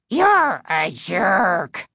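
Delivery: slow, rasping dummy growl; low throaty growl. A narrator's reading. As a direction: growl it like an evil puppet. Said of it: "rasped ... low, throaty growl".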